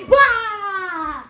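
sudden loud jump-scare shout; playful scare yell. Retold or imitated. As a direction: yell to startle someone. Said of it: "I'm like".

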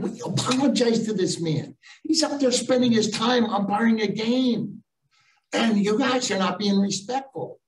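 mock yelling lecture; retold scolding yell. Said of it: "I'm yelling at them".

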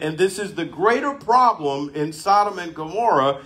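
slow, high, emphatic pulpit delivery; forceful preaching. Acted. Preacher driving home judgment to congregation, slow and forceful. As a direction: preach it out, driving each phrase.

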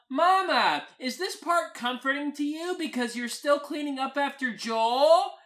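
high, loud call to mama; yelling. Acted. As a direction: holler a question across rooms, rising at the end. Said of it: "I yell".

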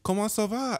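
imitated film character on the phone, in French; high French caller. French woman phones her friend, high imitated voice. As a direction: pitch it high, sing-out French greeting. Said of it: "she's like".

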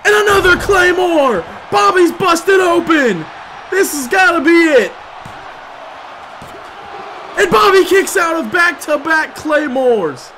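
screaming the near fall; excited shout. Real, not acted. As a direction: sell it like the match is over. Back-to-back finishers, near fall.

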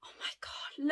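breathless whispered awe; hushed, amazed whisper; awed whisper. Real, not acted. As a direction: whisper it, stunned by the colour. Sees earrings.

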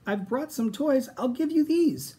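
high pleading dino voice. Acted. Minosaur pleads with the dinos who ignore him, high. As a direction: beg them to let him join. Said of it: "tried saying".

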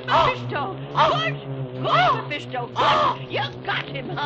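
high, loud cheering on of the bird; frantic urging. Acted. Keeper urging Mephisto to pluck the Whir's eyes, frantic cheering. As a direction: egg on the bird in a frenzy.

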